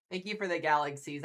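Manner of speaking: ordinary delivery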